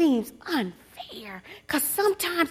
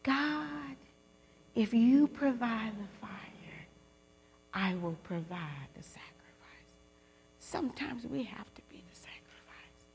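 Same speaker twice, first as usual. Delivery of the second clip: whispered prayer; 3 semitones lower, 12 dB quieter than usual. Real, not acted. Preacher leading the women in a whispered prayer. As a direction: let the prayer sink to a breath. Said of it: "say it with me".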